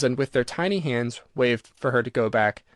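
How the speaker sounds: ordinary delivery, nothing marked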